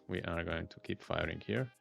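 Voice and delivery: ordinary talk, nothing marked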